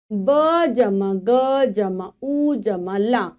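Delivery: slow, sound by sound blending; teacher spelling for kids. Shown as a demonstration. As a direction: build the word one sound at a time. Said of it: "यहाँ पर हम देख रहे हैं".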